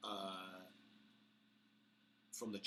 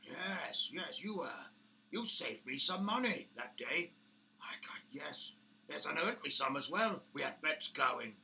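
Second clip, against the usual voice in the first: higher, louder amused orc voice; orc boss, gloating; 8 semitones higher, 8 dB louder than usual. Acted. Orc boss recalling bets with Bramble, gloating voice. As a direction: savour the memory of winning bets.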